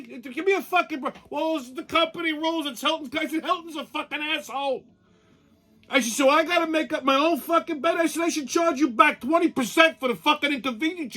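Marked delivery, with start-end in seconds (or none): 0.0-3.1 high-pitched indignant rant; fired-up high complaint; retold rant. Ranting retold hotel argument. 3.4-11.1 high-pitched indignant rant; fired-up high complaint; retold rant. Retold yelling at clerk.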